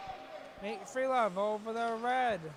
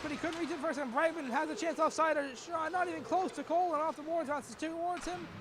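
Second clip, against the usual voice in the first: normal talking voice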